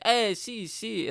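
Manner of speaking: high, slow mock Spanish reply; imitated Spanish speakers. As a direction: play a clueless Spaniard answering proudly. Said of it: "Spanish people speaking Spanish".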